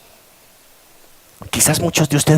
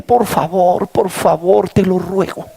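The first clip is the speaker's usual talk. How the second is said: repeated, higher begging; pleading; 5 semitones higher, slower than usual. Retold or imitated. Groom begging his fiancée not to fail him, pleading. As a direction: plead with her, over and over. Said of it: "Yo le dije".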